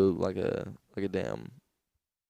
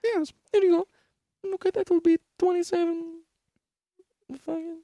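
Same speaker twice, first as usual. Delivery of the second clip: much higher, falsetto shopkeeper voice; high vendor voice; 4 dB louder than usual. Retold or imitated. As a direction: squeak it out like a shopkeeper.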